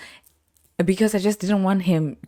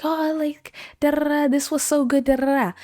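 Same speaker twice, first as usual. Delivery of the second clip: gushing guests; 9 semitones higher than usual. Retold or imitated. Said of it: "they were like".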